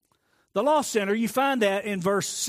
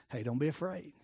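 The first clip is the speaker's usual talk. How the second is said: gentle reassurance; 2 semitones lower, 9 dB quieter than usual. Acted. David calming his fears.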